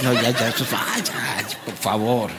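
low, different voice begging them to stop; a deep put-on plea for quiet; mock pleading. Host to laughing crowd, low mock pleading voice.

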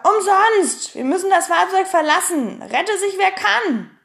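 loud, high cry of alarm; alarmed shout. Acted. Pippi orders the crew off the sinking boat, alarmed shouting. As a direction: call out to abandon ship. Acted shipwreck alarm, abandon ship.

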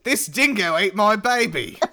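loud, slow, high hammed-up Australian; mock Australian accent. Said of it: "That was my Australian".

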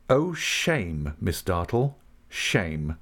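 plain reading voice

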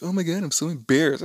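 mock whine, pitched high; embarrassed daughter. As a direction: imitate a mortified teen daughter.

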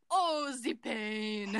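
very slow, drawn-out mock suffering; milk each word for hammy agony; [name] play-acting pain. [name] being hugged after the muffins, in mock agony. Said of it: "[name] acting".

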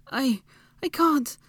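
high, slow, halting strain; grunted reply. A narrator's reading. Trapped Hermione answering Draco, grunting. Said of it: "Hermione grunted back".

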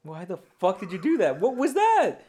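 high, loud, incredulous demand; angry outburst. Real, not acted. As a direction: build to an exasperated shout. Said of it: "why are you getting so mad".

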